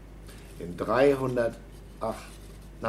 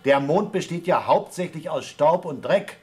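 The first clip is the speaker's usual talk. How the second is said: loud over the radio; 5 semitones higher, 5 dB louder than usual. Acted. Talking loudly over radio link.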